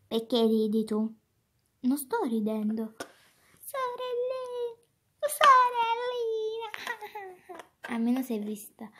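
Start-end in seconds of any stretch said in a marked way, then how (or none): 3.6-4.8 calling little sister. Pretend. 5.4-6.7 high, loud, drawn-out sorellina; loud calling. Pretend. Calling the little sister, loud high cry.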